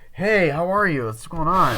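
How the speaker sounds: loud, high acted greeting; flustered greeting. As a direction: imitate an over-eager hello. Retold flustered greeting after being startled.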